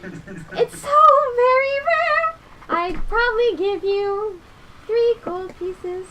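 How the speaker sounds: high, slow sing-song merchant; drawn-out haggling trader; shopkeeper voice. Acted. GM voicing shopkeeper NPC.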